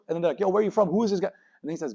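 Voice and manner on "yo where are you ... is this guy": high, louder excited voices; hyped-up crowd voice, pitched up; excited judges. Retold or imitated. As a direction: call out in amazement.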